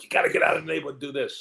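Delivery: fast, eager big-brother push; excited brother. Retold or imitated. Brother urging him toward drama, fast and excited. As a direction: rattle it off, fired up to get him out. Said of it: "My brother was so excited".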